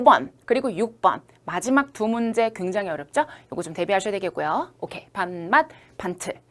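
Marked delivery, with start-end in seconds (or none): none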